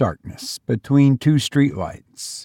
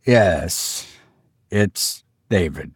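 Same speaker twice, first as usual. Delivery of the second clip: low, drained monotone; toneless, subdued husband; 6 semitones lower, slower than usual. A narrator's reading. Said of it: "His voice was monotone".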